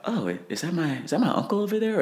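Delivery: very high, mock-puzzled wondering; surprised inner thought. Fighter voicing a mid-fight thought about his uncle, mock surprise. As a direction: pitch shoots up as if spotting someone.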